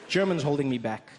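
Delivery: ordinary talk, nothing marked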